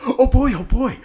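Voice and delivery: put-on excited like a kid; giddy excitement. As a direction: bounce with greedy glee.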